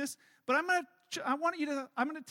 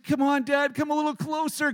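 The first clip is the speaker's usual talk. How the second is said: put-on loud, strained plea from a nervous kid; pleading child; 3 semitones higher, 8 dB louder than usual. Nervous son to dad below, loud pleading. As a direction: beg Dad to come closer, loudly. Voicing scared son on the edge.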